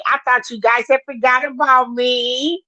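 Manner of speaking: ordinary delivery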